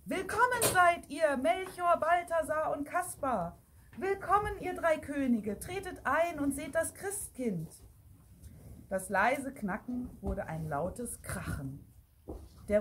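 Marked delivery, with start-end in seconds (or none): 0.0-7.7 high, ceremonious welcoming call; raised-pitch proclamation, moderately loud. A narrator's reading. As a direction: announce the guests to the whole church. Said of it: "hörte man den lauten Ruf".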